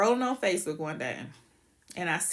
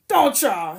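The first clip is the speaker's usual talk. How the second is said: repeated much louder, demanding; insistent; 10 dB louder than usual. Real, not acted. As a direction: push harder, nearly shouting. Pressing viewers to agree.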